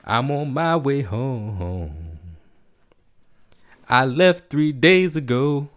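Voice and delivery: slow, soulful spoken-word delivery. Retold or imitated. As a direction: draw out each line slowly. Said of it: "my best [name] impression".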